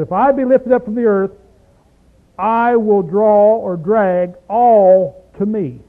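ALL drawn out slow and loud; slow emphatic scripture. Shown as a demonstration. Preacher reading the verse to the room, slow and loud. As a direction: lean on the word all, slowly.

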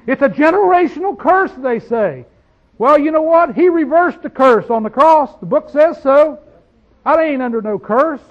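full-voiced revival preaching; preaching at full force. Real, not acted. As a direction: drive it home to the congregation.

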